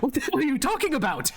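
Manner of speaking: a knight snapping at the very idea of pardons; outraged outburst. Acted. Knight jumping up at the Count's representative, an outraged shout. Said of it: "almost loses his mind".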